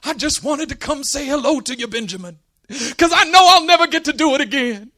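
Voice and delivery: high-pitched tearful old great-uncle imitation; mock crying. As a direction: wail a hammed-up farewell. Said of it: "And he's crying".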